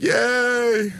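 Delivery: mock cheer. Fans cheering mockingly.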